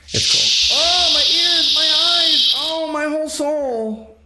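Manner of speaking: high, loud, drawn-out comic wail; mock agonized wail. Streamer reacting to a loud blast, mock agonized wail.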